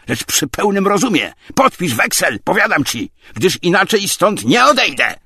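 loud, high, insistent harangue; furious tirade. A narrator's reading. Tarantiew demands a promissory note, furious tirade. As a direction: hammer out accusations and demands. Visitor yells accusations and demands.

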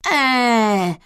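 unimpressed drawn-out whine; protesting groan. A narrator's reading. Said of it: "zaprotestowali jednocześnie Gienek i Kresek".

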